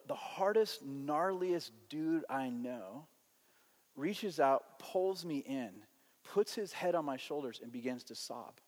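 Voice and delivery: usual speaking voice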